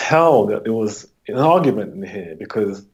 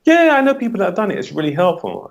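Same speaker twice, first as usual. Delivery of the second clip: fast, much higher client voice; lightly voiced believer, high and quick; imitating a client; 11 semitones higher than usual. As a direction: play the client rushing to agree, pitched way up. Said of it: "I said".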